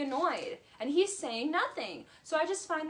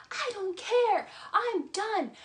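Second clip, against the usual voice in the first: imitating [name] yelling; 10 semitones higher than usual. Vlogger imitating [name] yelling at her boyfriend, high mock yell. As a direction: shriek it like she's at her limit. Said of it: "And she yells".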